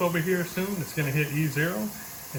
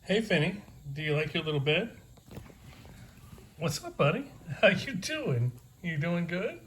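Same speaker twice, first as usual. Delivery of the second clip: talking to a dog; 3 semitones higher than usual. Real, not acted. Talking to his puppy Finny.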